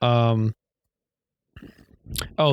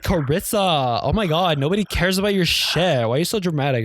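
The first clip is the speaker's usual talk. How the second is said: higher, exasperated mock scolding; dramatic theater kid; 5 semitones higher than usual. Theater kid snapping back at Carissa, mock-dramatic. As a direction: play a fed-up drama girl telling off her friend. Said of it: "And then she's like".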